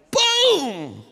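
high, loud drawn-out boom; explosive punch sound effect; booming impact. Retold or imitated. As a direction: shout it as the punch lands. Imitates the punch landing.

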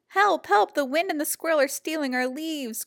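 loud high cries of help; crying out for help. Acted. Fletcher calling for help as leaves are stolen, loud and high. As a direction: call out in alarm, pitched high and loud. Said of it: "cried Fletcher".

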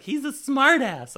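high, slow, loud sneer; shrill mock of the drunk lady. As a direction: mimic the snooty drunk woman. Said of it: "I overheard her going like".

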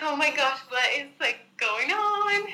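rising to a high, disbelieving finish; mock-alarmed, pitch soaring at the end; retold bewilderment. Retells her bewildered reaction to symptoms.

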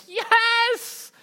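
put-on a thrilled kid's yelp; excited kids' cheer. Kids cheering at the ice cream promise, squealing.

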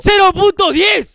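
high, loud, slow outrage; outraged announcement. Real, not acted. Streamer announcing ten cents, loud and slow. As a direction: announce the amount like a tragedy.

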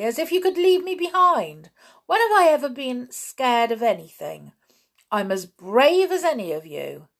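high, loud indignant protest; angry protest. A narrator's reading. George protesting to Julian, high and loud angry. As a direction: fight back at being left behind. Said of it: "said George angrily".

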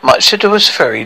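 usual speaking voice

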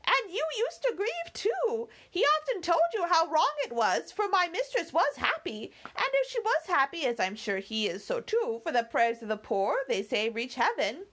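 put-on high, chattering old housekeeper voice; fussy old servant. Housekeeper nagging her young mistress to come inside, high fussy voice. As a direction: fuss and scold like a doting maid. Theresa keeps talking of grief.

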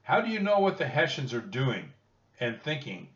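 mildly shouted over a storm; shouted demand. A narrator's reading. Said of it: "the major shouted above the storm".

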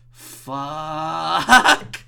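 long, loud drawn-out curse; frustrated outburst. Real, not acted. Podcaster losing the battle, loud drawn-out frustration.

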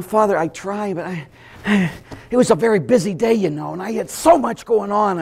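high, halting self-justification; mock whining excuse. Parishioner excusing missed prayer to Father, high feeble whine. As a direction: plead busy with a helpless sigh.

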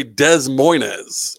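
"Des Moines" with every S sounded; mock hyper-literal reading of "Des Moines"; local mispronunciation. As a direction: say "Des Moines" as dezz moy-nezz. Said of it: "people in around here that say".

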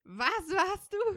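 high, slow astonished question; disbelieving, drawn-out question; shocked. Real, not acted. Shocked at the news.

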